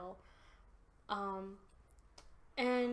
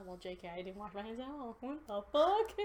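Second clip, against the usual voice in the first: usual speaking voice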